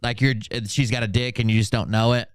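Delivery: normal talking voice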